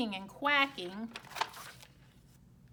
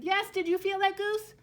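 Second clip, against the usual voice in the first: much higher and louder, breathless; excited; 8 semitones higher, 8 dB louder than usual. Acted. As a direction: burst out thrilled. Egg moves.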